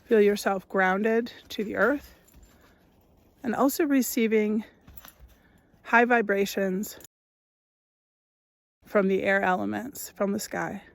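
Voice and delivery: measured meditation voice, long pauses between phrases; guided meditation. Acted. Guide listeners into noticing their breath, guided meditation voice. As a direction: lead listeners gently through each step, leaving space.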